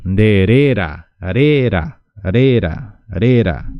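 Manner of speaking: "rera" loud and clipped, with a single tapped r; r sound drill. Shown as a demonstration. Teacher isolates the tapped r for students, loud. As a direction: tap the r in "rera" cleanly, syllable by syllable. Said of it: "No confundan la primera R".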